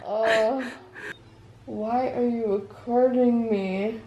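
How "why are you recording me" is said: ordinary talk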